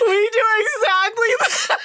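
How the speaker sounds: squealed through laughing, very high; real, high-pitched laughing speech; helpless laughter. Real, not acted. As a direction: get the words out while cracking up. Player cracking up at the scene.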